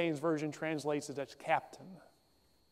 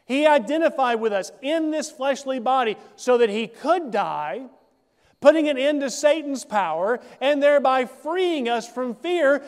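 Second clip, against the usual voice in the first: rising higher and louder, slowing on the peak words; preaching building to full force; 7 semitones higher, 9 dB louder than usual. Acted. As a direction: preach it up to the climax. Sermon builds to climax.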